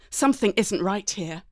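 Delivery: ordinary delivery, nothing marked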